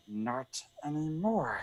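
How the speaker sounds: slow, hushed creature voice; Smeagol. Acted. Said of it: "I'm gonna do the impression now".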